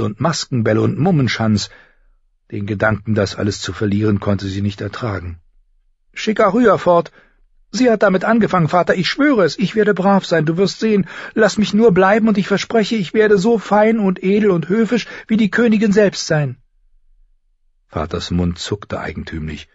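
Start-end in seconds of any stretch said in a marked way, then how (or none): 6.1-16.6 high, insistent begging; pleading girl. Acted. Sansa promises father to behave, desperately pleading.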